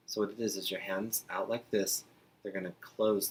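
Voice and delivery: ordinary talk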